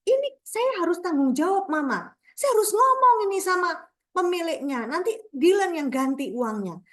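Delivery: high-pitched, retold wail of a child; high mock child's outcry, easing toward the end; child's mock scream. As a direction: imitate a child crying out to mum, pitch way up. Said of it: "anak saya menjerit bilang".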